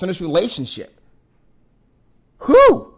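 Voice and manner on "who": high, loud, one-word demand; exasperated shout. Real, not acted. Questioning who leads the relationship, shouted. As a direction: call it out like a challenge. Rhetorical challenge about the boss.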